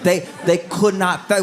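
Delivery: usual speaking voice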